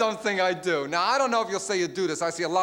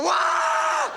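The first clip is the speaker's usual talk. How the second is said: a high, loud fake crowd laugh "Wah!"; mock audience roar; 4 dB louder than usual. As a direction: blast out a canned-laughter whoop. Laugh track audience roar imitated.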